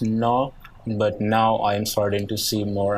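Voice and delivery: ordinary talk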